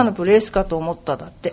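low, soft aside under a laugh; serious mutter. Real, not acted. Pro golfer to guests on being called sadistic, muttered. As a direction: mumble it quietly, half to yourself. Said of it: "本気でボソッと言いましたけどね".

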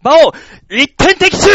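a loud, drawn-out cry of triumph; mock victory shout. Narrator reliving his win in the car, triumphant shout. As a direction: crow over the win like a jackpot. Celebrating a winning bet in car.

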